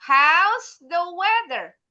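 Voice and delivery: "How's the weather" slow and high, then falling; falling intonation model. Shown as a demonstration. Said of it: "repeat after me". ("How's" tinggi, "weather" turun pelan)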